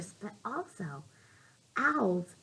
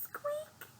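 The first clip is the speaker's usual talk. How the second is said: high mouse squeak; 16 dB quieter than usual. Acted.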